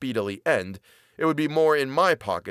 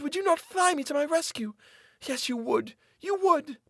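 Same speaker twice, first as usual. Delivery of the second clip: much higher, desperate woman begging; pleading captive woman. A narrator's reading. Captive woman pleading with her jailer for mercy. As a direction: beg for mercy in a high woman's voice.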